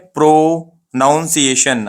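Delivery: "प्रोनाउंसिएशन" slowly, with "pro-noun" at the start; common mispronunciation. Shown as a demonstration. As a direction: break "प्रोनाउंसिएशन" into pro, noun-see-ay-shun. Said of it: "अपन ऐसा पढ़ते हैं". ("प्रोनाउंसिएशन" में "नाउन" बोलें)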